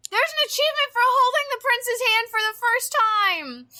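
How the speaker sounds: high, loud squealing gush. Real, not acted. Telling friends about the hand-holding achievement, high squeal. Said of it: "became squealing".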